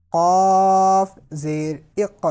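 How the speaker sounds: slow, loud spelled-out syllable drill; drawn out like a Quran teacher drilling a class. Shown as a demonstration. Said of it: "teacher drilling letter sounds".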